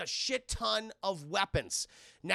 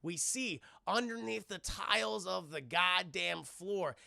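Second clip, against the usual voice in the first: normal talking voice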